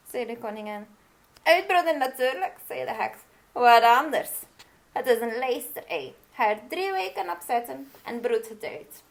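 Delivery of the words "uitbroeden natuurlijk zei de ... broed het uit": higher, louder no-nonsense witch; brisk witch. Acted. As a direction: tell her off like an impatient old witch. Witch instructs queen.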